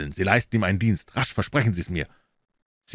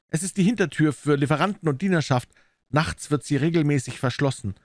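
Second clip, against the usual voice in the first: higher-pitched voice for the client; story figure of Phelps; 8 semitones higher than usual. Acted. Client explains the back door to the detective, higher character voice.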